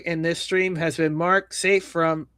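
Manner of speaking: ordinary talk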